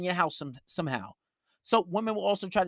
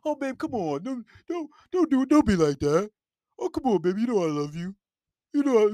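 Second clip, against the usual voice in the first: put-on higher, whiny begging boyfriend; pleading simp; 4 semitones higher than usual. Mocking a boyfriend begging the girlfriend who told him to leave, whiny. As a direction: grovel and whine at her to come back.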